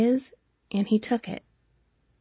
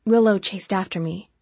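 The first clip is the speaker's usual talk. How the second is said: ordinary delivery, nothing marked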